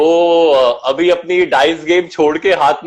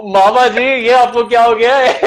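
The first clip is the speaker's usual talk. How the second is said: laughing; 6 semitones higher than usual. Real, not acted. Laughing at costume on video call.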